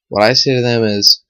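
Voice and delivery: usual speaking voice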